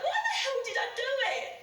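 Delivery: high, rushed outburst at oneself; anguished self-reproach. Acted. Driver blaming herself for the crash, agitated. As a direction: pitch up and rush it in disbelief.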